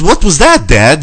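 put-on high, loud little girl voice. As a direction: ask like a curious young girl. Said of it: "So the girl goes".

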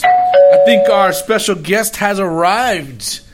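slow, loud host introduction; announcer intro. Acted. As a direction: announce the guest grandly. Introducing the special guest.

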